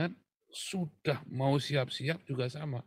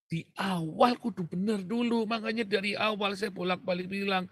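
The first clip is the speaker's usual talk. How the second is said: preaching at full force; 7 semitones higher than usual. Acted. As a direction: press every point, raised and deliberate.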